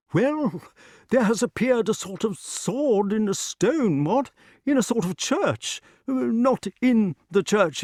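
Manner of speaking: high, dithering character voice; King Pellinore, muddled. A narrator's reading. Pellinore muddles his account of the sword, high and flustered. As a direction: flounder through it in a high voice.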